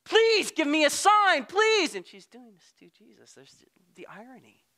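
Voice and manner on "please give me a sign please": high, slow, loud begging; desperate plea. Pretend. Frazzled believer pleading with God, high and loud. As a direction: beg for a sign in despair. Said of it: "Everything seems like it's falling apart".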